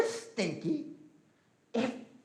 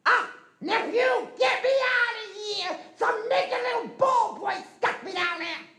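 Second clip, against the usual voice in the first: much higher, loud pleading yell; desperate eagle shout; 4 dB louder than usual. Acted. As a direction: yell to be pulled out, frantic. Trapped eagle begs for rescue.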